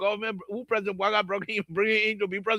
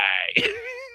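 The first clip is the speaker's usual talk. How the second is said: a squealing burst of laughter; high shrieking laugh; 14 semitones higher than usual. Real, not acted. Laughs at Mama Musa spy.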